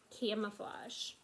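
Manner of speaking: ordinary delivery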